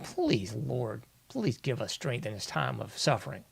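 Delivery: half-hearted pretend praying under the breath; mock prayer.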